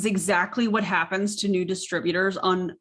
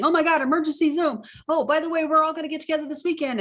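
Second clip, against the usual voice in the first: higher, rushed mock panic over emergency calls; mock frantic panic; 8 semitones higher, faster than usual. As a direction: lightly parody the chaotic upline. Mocking frantic MLM team chatter.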